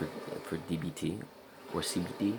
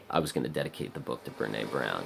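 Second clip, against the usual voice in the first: ordinary talk, nothing marked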